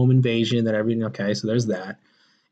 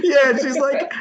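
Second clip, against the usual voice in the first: laughing; 10 semitones higher, 3 dB louder, slower than usual. Real, not acted. Laughing recalling the scene.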